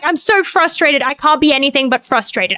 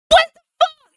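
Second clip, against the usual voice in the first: very high squeal of disbelief; high-pitched exasperated yelp; exasperated outburst; 12 semitones higher than usual. Real, not acted. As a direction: squeak it out, incredulous. Outburst over melted stand mixer.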